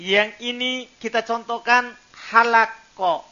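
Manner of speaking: halako with a clear breathy h, each word set apart; pronunciation contrast. Shown as a demonstration. Teacher contrasting similar words for students, careful demo. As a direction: say halako with a clean h and a slow lead-in. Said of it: "masing-masing ini beda".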